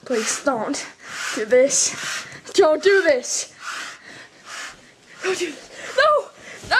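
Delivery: loud desperate pleading; begging. Acted. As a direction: plead for his life. Begging the killer.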